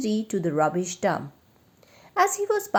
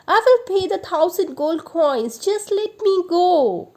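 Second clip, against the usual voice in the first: desperate pleading; 10 semitones higher, 6 dB louder than usual. A narrator's reading. Beaten thief begging to pay and be freed. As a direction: beg for mercy in desperation.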